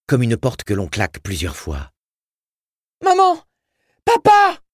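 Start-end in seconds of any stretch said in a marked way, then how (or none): 3.0-4.6 put-on small boy's piercing high yell; terrified scream. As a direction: scream for your parents in terror. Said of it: "hurla-t-il, terrorisé".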